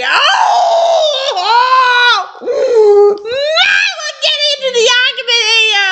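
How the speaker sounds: high, drawn-out groan of dread; dramatic wail. Real, not acted. Reader dreading the argument here, dramatic wailing. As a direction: wail it out, overwhelmed by the story.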